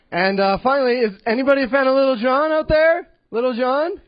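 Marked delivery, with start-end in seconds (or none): none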